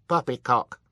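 high, loud, firm dismissal. A narrator's reading. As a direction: dismiss it firmly in one word. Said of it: "Sonny said firmly".

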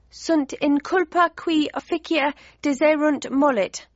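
put-on a little boy gurgling nonsense Latin; toddler babble. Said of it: "he replied".

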